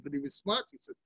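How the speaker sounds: ordinary talk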